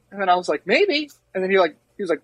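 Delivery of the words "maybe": high-pitched, unconvinced little voice; mock-doubtful. Recruit answering his buddy's comfort, mock-doubtful.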